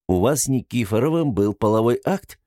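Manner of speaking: ordinary delivery, nothing marked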